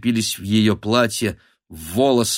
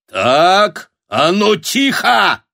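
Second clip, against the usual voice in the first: barked order; 10 semitones higher, 6 dB louder, much slower than usual. A narrator's reading. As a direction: bellow the crowd down. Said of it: "гаркнул Шишкин". (гаркнуть, требуя тишины)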